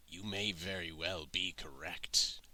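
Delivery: slow, low, utterly serious; deadpan. Acted. Old man answering a fairy joke, deadpan serious. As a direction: take the silly suggestion dead seriously, softly and low. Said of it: "deadpan, a hundred percent serious".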